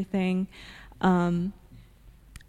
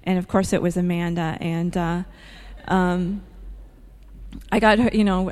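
ordinary talk

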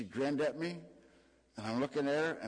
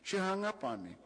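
usual speaking voice

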